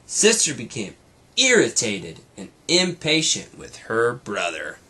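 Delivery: lively read-aloud storytelling voice; brighter, fuller storybook reading; story narration. A narrator's reading. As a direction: read it like a bedtime story to a child. Reads story to child.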